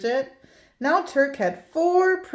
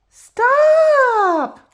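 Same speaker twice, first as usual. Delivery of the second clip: high, loud alarmed shout; cried out; 14 semitones higher, 10 dB louder than usual. A narrator's reading. Teacher shouting at Bridget to stop, high and loud. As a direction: yell it to stop the child. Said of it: "cried Missus Pickles".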